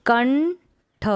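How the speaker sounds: कंठ stretched very slow, syllable by syllable; slow syllable split. Shown as a demonstration. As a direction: draw out कंठ slowly for the class. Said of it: "पढ़ने का अभ्यास करेंगे". (कंठ को बहुत धीरे तोड़कर)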